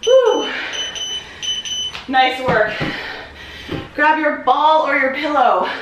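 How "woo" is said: high loud cheer; excited whoop. Real, not acted. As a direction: celebrate the finished set. Cheering after finishing set.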